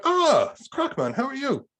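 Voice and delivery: overdone high-pitched friendly hello; mock cheery greeting. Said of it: "And I went".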